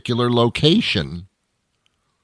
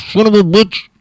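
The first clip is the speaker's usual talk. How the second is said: much higher, louder outburst; angry curse; 10 semitones higher, 7 dB louder than usual. Acted. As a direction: blurt the curse in exasperation. Giving up at drive-through.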